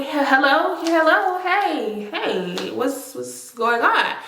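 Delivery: lightly put-on phone greeting, rising hellos; answering the phone. Answering his unexpected call, a bright mock hello. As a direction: act out picking up the call, sweet and curious. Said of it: "but he called me".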